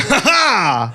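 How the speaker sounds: hammed-up cackle of recovery; mock triumphant laugh. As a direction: crow a put-on laugh. Barista laughs after tripping at door.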